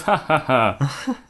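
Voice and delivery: slow fake laugh.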